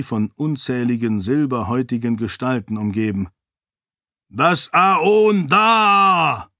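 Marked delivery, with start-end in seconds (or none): 4.3-6.5 booming, slow, drawn-out order; Galadon booming command. A narrator's reading. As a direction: thunder the command to the crowd. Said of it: "befahl Galadon mit dröhnender Stimme".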